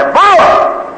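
high-pitched acted "get out"; sharp dismissal. Girl throws out her unwanted suitor, high cry. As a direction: send him away with a leap in pitch.

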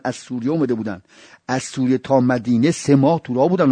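ordinary delivery

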